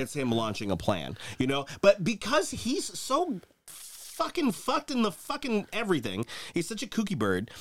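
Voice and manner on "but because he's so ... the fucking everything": exasperated. Real, not acted. Exasperated rant about Trump.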